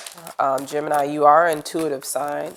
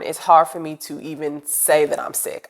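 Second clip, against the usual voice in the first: ordinary talk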